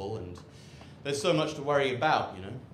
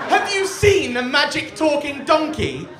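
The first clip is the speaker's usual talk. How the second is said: high, loud, drawn-out astonishment; hammed-up excited shout; mock amazement; 12 semitones higher, 10 dB louder than usual. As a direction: imitate an awestruck crowd, shouting slowly. Acted crowd amazed by talking donkey.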